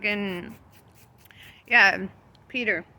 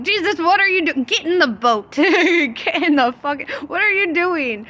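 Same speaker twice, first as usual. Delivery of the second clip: high, loud yell at Jesus, laughing between; hammed-up call to get back in; mock alarmed yell; 9 semitones higher, 6 dB louder than usual. Disciples shouting to Jesus on the water, mock alarm.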